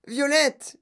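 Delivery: high cry of a name; panicked yell. A narrator's reading. Mother calling to swelling daughter, panicked yell. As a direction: call the name out in alarm. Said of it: "hurla madame Beauregard".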